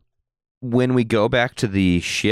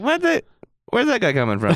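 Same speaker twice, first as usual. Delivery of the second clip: high-pitched, incredulous reaction; mock-startled, pitch leaping up then easing; retold bafflement. Host retells hearing a Scottish Imperial, baffled.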